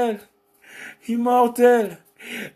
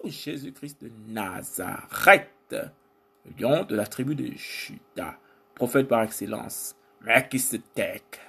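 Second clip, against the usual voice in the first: rhythmic litany of divine titles; spoken worship in short, driving phrases; spoken praise; 8 semitones lower, 4 dB quieter than usual. Real, not acted. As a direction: declaim each title as praise to God. Declaims titles of God.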